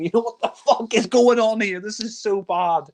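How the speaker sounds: exasperated, dragging out the last words; exasperated disbelief. Retold or imitated. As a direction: protest at the screen in disbelief. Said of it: "I'm just watching this and saying".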